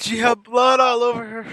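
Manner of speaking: loud horrified outburst; shocked shout. Real, not acted. Seeing Granny covered in blood, shouts in horror. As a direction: shout it out in disbelief.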